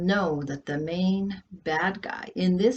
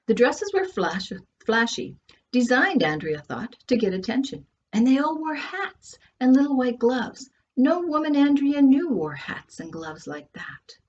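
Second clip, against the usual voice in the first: lively storyteller reading a novel aloud; story narration; 7 semitones higher, 4 dB louder than usual. A narrator's reading. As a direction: read it to the audience like a lively storyteller. Reads her novel aloud.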